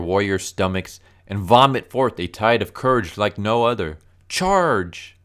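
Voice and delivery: loud, high heroic battle speech; rally the troops, building to a shouted charge; over-the-top warrior's war cry; Mince, rousing encouragement. Acted. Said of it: "that's supposed to be, like, encouraging".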